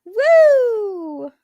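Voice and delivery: high loud woo; excited whoop. Real, not acted. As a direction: cheer it out.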